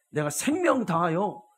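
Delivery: full-force sermon climax; fiery preaching. Real, not acted. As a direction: preach it like a charge to the flock.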